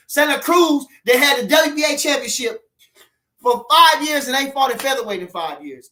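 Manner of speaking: getting fired up about an unfair title; worked-up rant. Real, not acted. Complaining to viewers about champions who never fight, heated.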